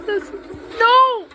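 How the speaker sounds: sudden high shouted no; shocked no. Real, not acted. Player yelling no at the scary face on screen. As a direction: cry out in horror at the face.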